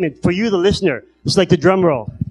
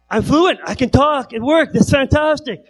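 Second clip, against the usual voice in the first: thrilled; 7 semitones higher than usual. Retold or imitated. Joy at being fluent after surgery.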